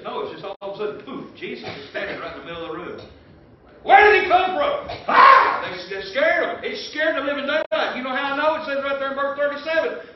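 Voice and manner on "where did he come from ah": high, loud frightened cry; startled shout. Acted. Preacher acting the startled disciples, frightened shout. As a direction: yell out like you just saw a ghost.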